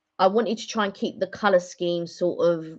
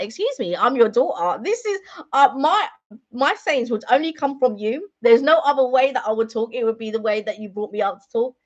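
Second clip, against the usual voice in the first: retold indignation, rising higher and louder; mock-affronted daughter, raised voice; indignant retort; 4 semitones higher, 4 dB louder than usual. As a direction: answer mum back, building in outrage. Acted indignant retort to her mother.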